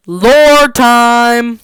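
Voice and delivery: very loud, drawn-out announcer shout; segment announcement. Real, not acted. Host announcing the lore segment, big shout. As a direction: belt it out like a show segment title.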